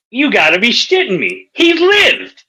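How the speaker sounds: high, incredulous outburst rising in pitch; can't believe the man survived; acted disbelief.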